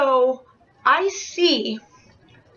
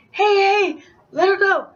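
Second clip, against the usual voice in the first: raised-pitch imitation of intervening; mock a higher urgent call-out; 7 semitones higher, 4 dB louder than usual. Friend trying to stop a fighter, calling out.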